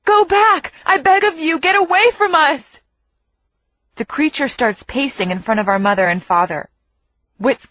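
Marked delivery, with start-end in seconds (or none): 0.0-2.6 high, pleading yell. A narrator's reading. Captive mother yelling to her children, pleading. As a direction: beg them loudly to get away. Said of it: "My mother yells out a second time".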